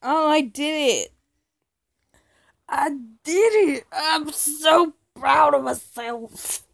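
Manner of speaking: loud, high, proud cheer; triumphant excitement. Real, not acted. Player celebrating own success, loud elated cry. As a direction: celebrate loudly, stretching the last words.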